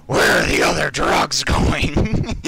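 a strained attempt at Batman. Player trying the Batman voice on request, botched. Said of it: "So for a Batman voice".

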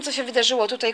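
normal talking voice

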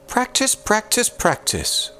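high sing-song nagging; mock Una repeating herself; quoting Una. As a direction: chant it like a patient nag. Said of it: "Una always tells me".

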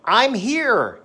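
high, loud classroom answer; loud drill voice. Shown as a demonstration. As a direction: call it back clearly. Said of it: "flashcard drill demo".

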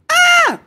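very high, very loud shriek. Acted. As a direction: outscream her in panic. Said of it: "screamed Bud even louder".

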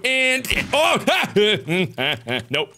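normal talking voice

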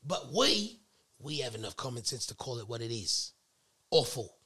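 mock-haughty British lecturing; British accent. Brit scolds uncouth Americans about their dishes, mock British accent. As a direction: play a Brit explaining food to Americans. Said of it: "read it in the British accent".

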